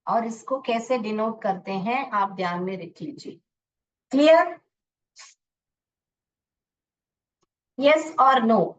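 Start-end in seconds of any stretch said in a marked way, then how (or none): 4.1-8.7 louder check. Real, not acted. Teacher checking class.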